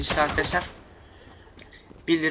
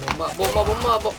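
excited; 5 semitones higher, 4 dB louder than usual. Real, not acted. Rushing to plant the bomb.

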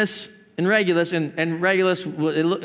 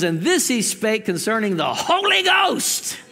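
full-force preaching; 6 semitones higher than usual. Real, not acted. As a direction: preach it out, driving each phrase home.

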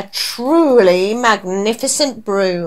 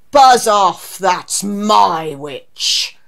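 terrible beast voice; 3 semitones lower, much slower than usual. A narrator's reading. Horrible beast confronts the dragon, terrible voice. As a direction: warn off a rival in a beastly voice. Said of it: "its terrible voice".

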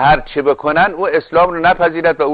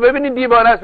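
judge's higher-pitched appeal, retold; imitated exasperated official, raised pitch; reluctant judge; 8 semitones higher, much faster than usual. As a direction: lightly act a judge pleading the case. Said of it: "قاضی دلش نمیخواست اینا رو بکشن".

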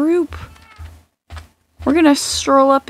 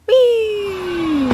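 playful whee; 7 semitones higher than usual. Real, not acted. Playful launch.

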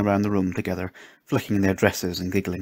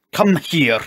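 loud, raised summons; stern angry command; 7 semitones higher, 6 dB louder than usual. A narrator's reading. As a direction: order the child over sharply. Angry father summons Eliza.

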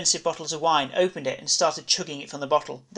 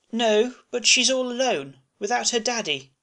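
tearful; 6 semitones higher than usual. A narrator's reading. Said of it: "as he started to cry".

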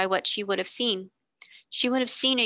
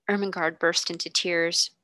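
ordinary delivery, nothing marked

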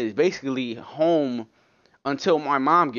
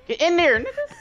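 high triumphant shout; excited shout; 5 dB louder than usual. Real, not acted. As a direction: cheer it out. Cheering game moment.

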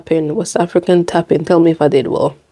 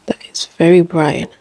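normal talking voice

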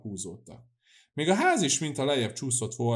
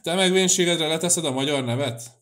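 loud, higher indignant comeback; growled retort; 6 semitones higher, 7 dB louder than usual. A narrator's reading. The priest fires back at Cecei, growled retort. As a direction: growl back with offended force. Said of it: "mordult vissza a pap".

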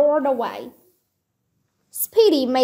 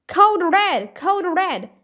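urgent alarm call, higher; radio shout; 5 semitones higher than usual. A narrator's reading. Ranger on the radio shouts an alarm. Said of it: "a man's voice shouted".

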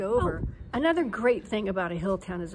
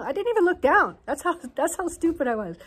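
louder and higher, self-mocking disbelief; exasperated exclaim; 7 semitones higher, 6 dB louder than usual. Real, not acted. Telling viewers about her gas spill, loud self-mocking disbelief. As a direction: belt it out at your own foolishness. Laughing at own gas mishap.

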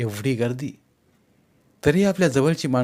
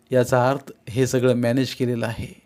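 plain reading voice

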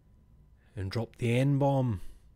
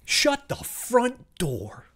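loud, high, slow hammed-up fury; hammed-up furious euphemism; mock angry outburst; 10 semitones higher, 9 dB louder, much slower than usual. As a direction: shout it like a prim woman pretending to swear. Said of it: "when she's really angry, she'll go".

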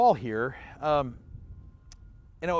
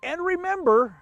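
much higher, sing-song "remember" as Paul; mock Paul reminder; 12 semitones higher, 5 dB louder than usual. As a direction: lift "remember" high like a nagging reminder. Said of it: "Paul says".

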